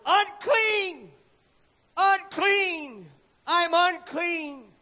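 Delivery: high, loud anguished cry of unclean, slowing at the end; the leper choking out unclean as he steps outside; choked-out cry. A narrator's reading. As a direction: cry out your shame to the street. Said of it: "choked out these dreaded words".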